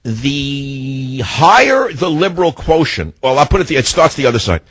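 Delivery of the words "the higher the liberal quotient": slow deliberate. Shown as a demonstration. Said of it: "There's an inverse quotient".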